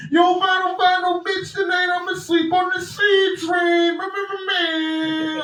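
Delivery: very loud, high club DJ yelling over music; DJ hype shout. Retold or imitated. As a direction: hype the crowd like a DJ. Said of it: "All I hear is".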